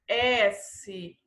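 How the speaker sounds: usual speaking voice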